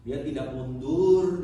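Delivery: Dia tidak mundur, loud and slow; loud slow declaration; emphatic refrain. Real, not acted. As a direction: punch out mundur. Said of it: "repeated refrain".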